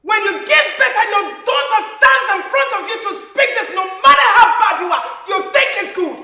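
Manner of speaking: high, very loud maternal tirade; furious shouting. Acted. Mother silencing her quarrelling children, furious shout.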